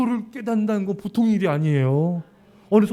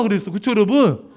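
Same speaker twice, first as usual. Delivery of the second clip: normal talking voice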